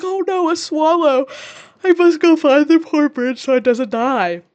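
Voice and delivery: a lightly voiced damsel faking worry over a bird; Kathy feigning alarm about a swallow; mock dramatic Kathy. Kathy's fake excuse voiced.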